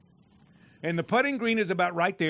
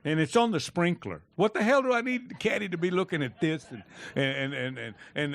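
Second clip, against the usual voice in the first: normal talking voice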